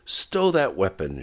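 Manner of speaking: urgent shouted command. A narrator's reading. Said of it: "shouted the captain".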